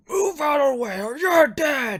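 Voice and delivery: high, roaring threat at full volume; angry orc. Acted. Orc threatening a man in his way, loud roar. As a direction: roar it, drawing out the last words. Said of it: "this orc is kinda confused, and he's like".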